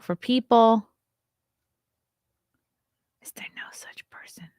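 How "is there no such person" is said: hushed, quick whisper. Real, not acted. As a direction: whisper it fast to yourself. Muttering while searching online.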